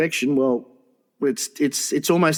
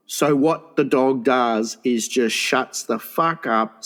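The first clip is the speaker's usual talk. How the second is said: normal talking voice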